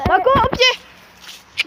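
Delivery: slow, high, loud call to a dog. Real, not acted. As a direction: call the dog to heel from afar. Calling the dog to heel.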